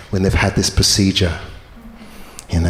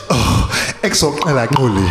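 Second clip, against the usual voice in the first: miffed South African; 2 semitones higher than usual. Retold or imitated. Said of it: "She gets mad at me".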